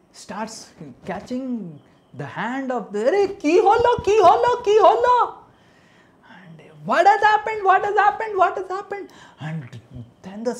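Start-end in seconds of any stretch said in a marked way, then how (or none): 3.0-5.2 high, loud shocked calls of ki holo; panicked high shout; alarmed cry. Acted. Sarpanch shocked at villagers. 6.8-9.1 high, loud, urgent repeated questions; panicked high shout; alarmed cry. Acted. Sarpanch repeats shocked question.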